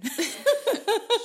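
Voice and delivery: loud squealing laugh; high laugh. Real, not acted. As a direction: burst out laughing. Laughs after introducing names.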